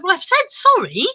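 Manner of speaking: mock indignant re-apology; exasperated protest. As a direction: imitate an exasperated apology, pitch swooping up. Acted quarrel, lady protests apology.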